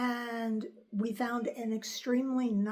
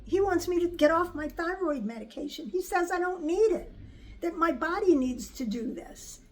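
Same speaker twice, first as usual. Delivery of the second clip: higher-pitched, mildly agitated retelling; retold worried protest; 7 semitones higher, 3 dB louder than usual. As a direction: relay your alarm to the doctor, pitch up. Retelling her worried plea to doctor.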